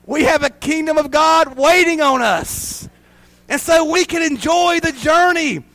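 high, loud preacher's cry; rally the church with fire; preaching. Acted. Pastor to congregation, full-force preaching.

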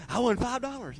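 high-pitched imitation of a small winner; hammed-up little burst of glee; mock excitement. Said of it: "they get excited".

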